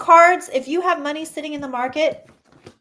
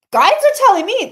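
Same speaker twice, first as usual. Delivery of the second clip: excited; 9 semitones higher, 10 dB louder than usual. Real, not acted. Psychic excited about reading.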